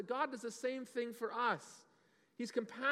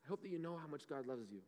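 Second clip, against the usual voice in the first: much softer, hushed aside to the grown-ups; softer for the adults; 5 semitones lower, 11 dB quieter than usual. Shown as a demonstration. Pastor turns softly to the adults, hushed. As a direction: drop the voice right down for the adults.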